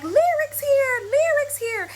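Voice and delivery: put-on high excited kids calling her name; excited children calling out at the playground. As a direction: squeal it like thrilled little kids greeting a friend. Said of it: "all the kids are like".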